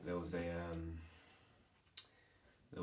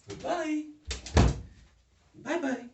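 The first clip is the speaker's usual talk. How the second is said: raised, high-pitched greeting; high loud hello and goodbye; calling out; 14 dB louder than usual. Real, not acted. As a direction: call across to someone, loud and high. Calling out to someone off camera.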